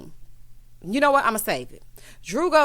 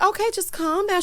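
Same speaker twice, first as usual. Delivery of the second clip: high-pitched imitation of placating someone; retold placating; 10 semitones higher than usual. Friend retold trying to settle Kenya over room key, high placating. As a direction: lift the voice high, imitating a plea.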